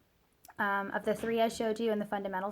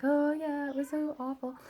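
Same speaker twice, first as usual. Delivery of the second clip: put-on higher-pitched little-kid voice; small, high child's lament; imitating upset daughter; 5 semitones higher than usual. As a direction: lightly mimic a child admitting she was upset. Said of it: "she cries and she feels better".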